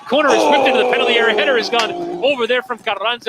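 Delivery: rising, rapid play-by-play call; excited commentary. Real, not acted. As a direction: quicken up as the ball comes in. Corner kick, live commentary.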